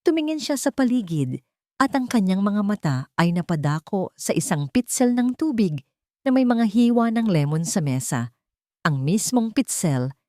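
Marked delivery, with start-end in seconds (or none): none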